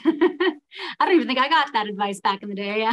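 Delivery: ordinary talk, nothing marked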